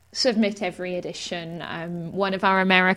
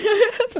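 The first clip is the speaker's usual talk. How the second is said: laugh; 6 dB louder than usual. Real, not acted. Laughs at accent joke.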